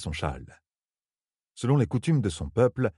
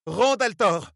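high, loud indignant shout of his name; outraged cry; 14 semitones higher, 4 dB louder, much slower than usual. A narrator's reading. Nineveh cries out at Rand, outraged. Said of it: "s'écria Nineveh".

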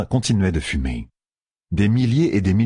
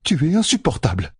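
lightly raised voice, scolding her companion; a woman scolding a vain friend; scolding Pevara; 8 semitones higher than usual. A narrator's reading. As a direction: tease him with a scolding edge. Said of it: "le tança Pevara".

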